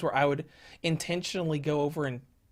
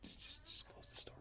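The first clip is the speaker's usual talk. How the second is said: frightened whisper; 4 semitones lower, 18 dB quieter than usual. Real, not acted. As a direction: whisper in fear. Hiding from nun, whispering.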